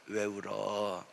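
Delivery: soft, slow question. Retold or imitated. Pastor asks the weeping member gently, slow and soft. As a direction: ask gently, as if to a crying child.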